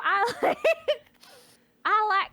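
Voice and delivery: high, stretched-out perky voice with giggles; hammed-up upbeat imitation; cheerful Scrub impression. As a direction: introduce yourself in a silly chirpy mock voice. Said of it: "That's how he sounds like in my head".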